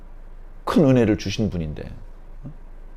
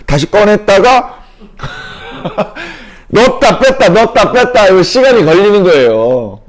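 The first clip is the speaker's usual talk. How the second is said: laughing dithering; 8 semitones higher, 8 dB louder than usual. Acted. Hesitating over the offering, laughing.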